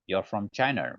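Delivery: ordinary delivery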